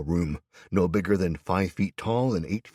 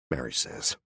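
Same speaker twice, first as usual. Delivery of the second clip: plain reading voice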